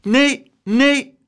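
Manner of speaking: high, loud, bold refusal; plucky, forceful shout; firm cry. A narrator's reading. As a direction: call out a stout no. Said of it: "riep Abeltje flink".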